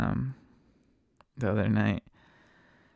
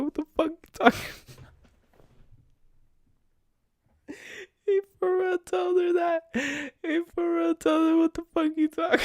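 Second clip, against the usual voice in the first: high squeal on the edge of laughter; cracking up; 3 dB louder, faster than usual. Real, not acted. Storyteller recalling passenger's retort, squealing with real laughter. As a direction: barely get it out, voice pitched way up. Cracking up while retelling the quarrel.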